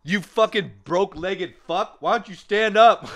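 high-pitched mock-angry heckle; mock outburst. Jokingly berating a paralyzed man for sitting, in high mock outrage.

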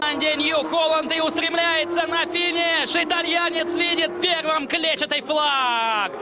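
motorsport commentator. Real, not acted. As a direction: call the winner home, excited. Race winner crosses finish line.

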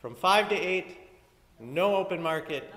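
loud, deliberate clarification; firm and loud. Real, not acted. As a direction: spell it out firmly. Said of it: "Let me be clear".

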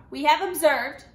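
put-on loud, commanding German-accented officer; loud accented officer demanding answers. A narrator's reading. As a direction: bark it out like a soldier. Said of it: "accented voice from the kitchen was loud".

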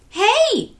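high, loud call; shout. A narrator's reading. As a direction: yell to stop them. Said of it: "someone shouted".

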